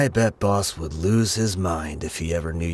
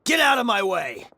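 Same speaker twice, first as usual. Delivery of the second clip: high, forceful yell; combat yell; 13 semitones higher than usual. Acted. Fighter forcing past foes, a combat yell. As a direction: shove through with a yell. Fighter charging through enemies.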